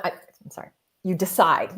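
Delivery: usual speaking voice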